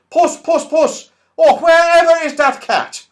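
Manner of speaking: very high, loud fussy calling for the cat; saucepan man calling. Acted. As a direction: call the cat in a fluster. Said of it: "hear the saucepan man call".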